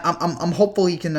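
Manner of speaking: ordinary talk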